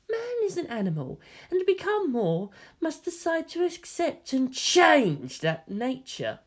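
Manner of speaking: a hint of a fanatic scientist; lightly voiced zealot. Karoo lecturing the general, lightly voiced and lofty.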